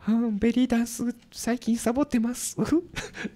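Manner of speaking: a sheepish confession in her voice; Kinjo Nanae impression. Host doing a colleague admitting she skipped belly dance. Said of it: "金城七重さん".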